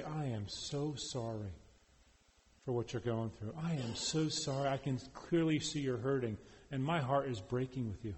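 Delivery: soft, low condolence; tender sympathy. Acted. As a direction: gently share in their hurt, hushed and sincere. Voicing comfort to someone grieving.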